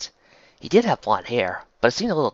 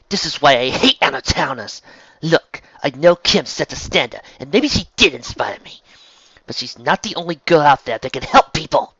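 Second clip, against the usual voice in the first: raised, loud, irritated protest; indignant rant; 5 semitones higher, 7 dB louder than usual. Acted. Girl defending herself against comparisons to Kim, indignant rant. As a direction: argue it hotly.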